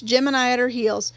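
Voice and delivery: plain reading voice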